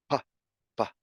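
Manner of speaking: "pa, pa" with a crisp p; p sound demo. Shown as a demonstration. Teacher repeating "pa" so learners catch the p. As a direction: pop the p in "pa, pa". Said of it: "like that".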